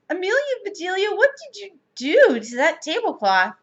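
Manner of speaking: high-pitched, appalled demand; dismayed scolding. A narrator's reading. Missus Rogers to maid about tablecloth, dismayed.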